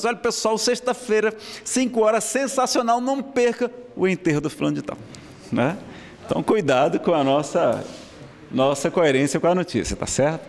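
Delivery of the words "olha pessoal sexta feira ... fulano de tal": high-pitched mock film announcer; habitual announcer pitch. Cousin announcing a funeral by habit, in mock movie-promo voice. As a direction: announce it like a sensational movie premiere. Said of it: "Só que ele tava tão habituado".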